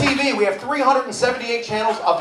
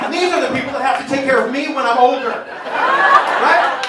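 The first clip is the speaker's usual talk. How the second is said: louder and higher, laughing; laughing shout; 5 semitones higher, 7 dB louder than usual. Real, not acted. Comic to audience about daughters caring for him, laughing shout. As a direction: shout the punchline to the crowd.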